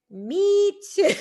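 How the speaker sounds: loud, high burst of real laughter; laughing. Real, not acted. Host agreeing about learning technology, laughing. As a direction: crack up while agreeing.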